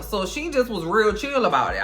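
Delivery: ordinary delivery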